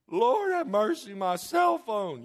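high, loud, drawn-out mock wail; hammed-up horror, stretching the words slowly; distraught teenager. Teenager losing cell phone as discipline, melodramatic wail. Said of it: "discipline nowadays is with a teenager".

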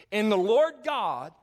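slow, loud, high preaching build; preaching climax. Acted. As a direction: pastor lifts congregation, slow loud proclamation.

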